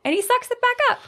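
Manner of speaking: very high, squeezed comic voice; squeaky mock strain. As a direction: say it as if sucking tears back up. Playfully imitating cartoon cop sucking tears up.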